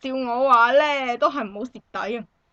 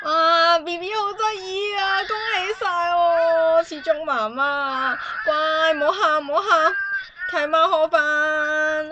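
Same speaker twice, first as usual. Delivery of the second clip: put-on higher, louder sing-song coo to a baby; cooing baby talk; 5 semitones higher, 4 dB louder than usual. Godmother soothing the crying newborn, higher and louder, sing-song cooing.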